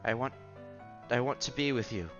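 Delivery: ordinary talk, nothing marked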